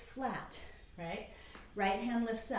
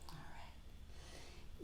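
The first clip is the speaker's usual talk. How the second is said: whispered; 16 dB quieter than usual. Real, not acted. Quiet moment in child's pose.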